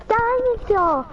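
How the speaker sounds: put-on squeaky high little-kid yell of a word; high kid shout. Kid imitating Peppa's little brother, squeaky high. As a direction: shout it like an excited toddler. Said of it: "she's like".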